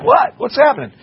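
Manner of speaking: high, startled outburst, acted; freaked out. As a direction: imitate alarmed passengers caught off guard. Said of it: "freaked out".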